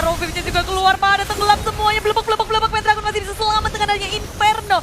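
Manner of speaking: high, loud, fast play calling; excited commentary. Real, not acted. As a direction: ride the fight's momentum. Co-caster builds on a fight.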